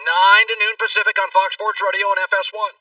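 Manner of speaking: loud radio promo read. Acted. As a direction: sell it like a station promo. Host reads podcast promo.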